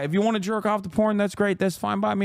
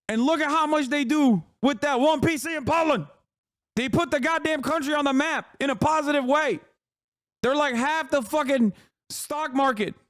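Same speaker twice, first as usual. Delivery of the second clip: raised-pitch emphatic rant; impassioned defence; 6 semitones higher than usual. Real, not acted. Streamer championing Poland's one game studio, fervent high rant. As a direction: argue it high and fervently, punching the words.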